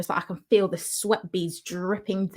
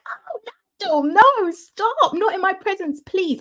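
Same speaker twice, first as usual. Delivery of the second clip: very high mock-horrified protest; inner exasperated plea; 12 semitones higher than usual. Guest silently begging him not to, high dramatic dismay. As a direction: plead in an exaggerated high squeal of dismay. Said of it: "in my head, that is what I'm thinking".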